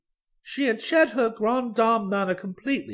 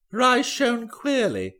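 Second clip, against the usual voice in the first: plain reading voice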